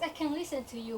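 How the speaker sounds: ordinary talk, nothing marked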